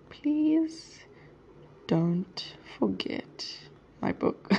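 very slow, soft, word-by-word murmur; typing aloud. Real, not acted. Texting a cousin a reminder, typing aloud. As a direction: sound out the words softly as you type.

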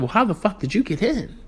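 high, incredulous how the fuck; indignant disbelief. Retold or imitated. Confronting the delivery man in high-pitched disbelief. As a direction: demand it in stunned outrage.

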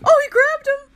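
high, loud startled shout; excited shout. Real, not acted. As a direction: shout at the screen. Shouting at game.

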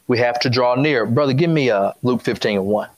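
ordinary talk

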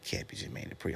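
soft unvoiced whisper to himself; whispered aside. Drunk at the party, he whispers to himself in disbelief. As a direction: breathe it out as a private aside.

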